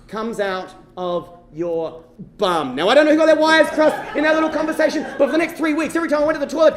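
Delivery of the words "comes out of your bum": very slow, word by word; drawn-out revelation. Retold or imitated. Older brother explains puberty to little brother, drawn-out revelation. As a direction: spell it out with long, weighty pauses.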